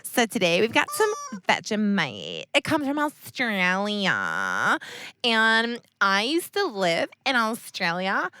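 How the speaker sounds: slow, drawn-out bad Australian accent; hammed-up Australian presenter voice; terrible Australian accent. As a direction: stretch the vowels in fake Australian. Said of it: "having a terrible accent".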